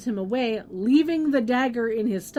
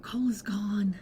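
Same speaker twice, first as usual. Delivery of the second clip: whispering; 4 dB quieter than usual. Acted. Whispered ominous musing.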